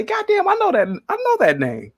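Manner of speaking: high, startled recognition; imagined shocked granddad. Retold or imitated. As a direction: jolt up in pitch as the name clicks. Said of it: "And you say".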